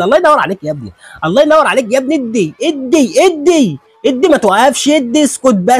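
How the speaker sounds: excited cheering. Real, not acted. Cheering a fighter's flurry.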